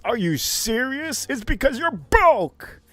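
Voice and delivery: high, slowed mocking jab; aggressive taunt. Real, not acted. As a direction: needle them about being broke. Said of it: "I'm getting too aggressive".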